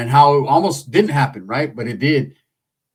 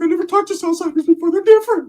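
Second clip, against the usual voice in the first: much higher eerie voice; spooked medium. Retold or imitated. Ricky describing the spirits, much higher and eerie. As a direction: keep the possessed medium going. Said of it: "He's like".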